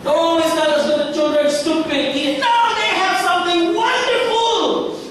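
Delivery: high, loud fervent plea; high ringing sermon cry; impassioned preaching. Real, not acted. Preaching climax.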